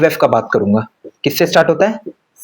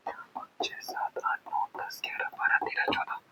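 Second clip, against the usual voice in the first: whispered counting; 19 dB quieter than usual. Real, not acted. Counting elements under his breath.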